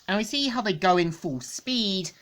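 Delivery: ordinary delivery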